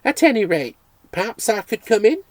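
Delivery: put-on a society girl inviting herself in; lightly voiced haughty lady; haughty young lady. Lady asks, narrator babbles.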